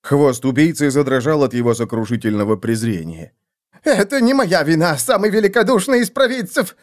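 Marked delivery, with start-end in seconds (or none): 3.8-6.8 high-pitched shrill protest; shrill cry. A narrator's reading. Assassin denies blame before the seer, shrill. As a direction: plead innocence in a piercing high voice. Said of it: "пронзительно закричал он".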